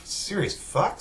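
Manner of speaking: high-pitched baffled question; mock bewilderment; retold disbelief. Kylo baffled after his slash fails.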